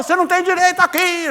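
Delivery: high-pitched mock scolding; retold quarrel. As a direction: imitate her laying into him. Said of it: "ela foi lá e brigou". (bronca imitada, em tom agudo)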